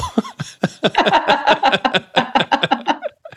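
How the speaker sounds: long, high-pitched laughing fit; helpless sustained laughter; real laugh. Real, not acted. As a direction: burst out in a long run of high giggles. Laughs at his own story.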